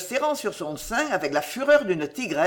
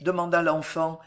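usual speaking voice

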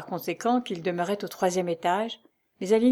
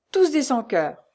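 indignant; 6 semitones higher, 6 dB louder than usual. A narrator's reading. Wife condemns all men.

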